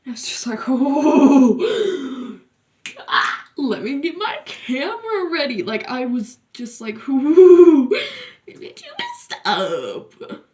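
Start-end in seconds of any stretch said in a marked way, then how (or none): 0.7-5.6 gleeful laugh through the words; enjoy the drama, laughing. Retold or imitated. Watching the couple fight, laughing with glee. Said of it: "saw that I was laughing". 7.1-10.4 gloating. Retold or imitated. Taunting the boy after his girlfriend's outburst, gloating.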